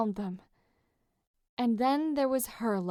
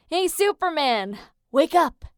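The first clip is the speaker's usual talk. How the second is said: louder, higher wake-up call; waking shout; 5 semitones higher, 7 dB louder than usual. A narrator's reading. Leo barges in to wake Jason, loud shout. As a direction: bellow him awake.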